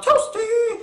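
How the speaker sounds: high yelp; yelled. Retold or imitated. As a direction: squeal it out like a game sound clip. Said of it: "he's yelling out".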